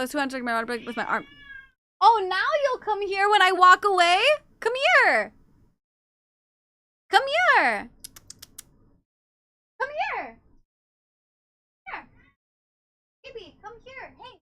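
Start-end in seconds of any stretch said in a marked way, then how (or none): 2.0-12.1 loud high calls after a cat that walks off; calling out to a stubborn cat. Real, not acted. Calling cat who walks away. 13.2-14.4 cat call. Real, not acted. Soft high call to cat.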